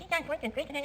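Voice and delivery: very high chattering monkey voice. Acted. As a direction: squeal it like an excited monkey.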